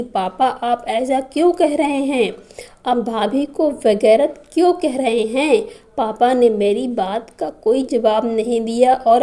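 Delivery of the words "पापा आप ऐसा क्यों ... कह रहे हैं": ordinary delivery, nothing marked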